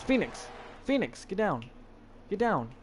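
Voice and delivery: firm name-calling to correct; scolding command. Real, not acted. Ordering Phoenix down.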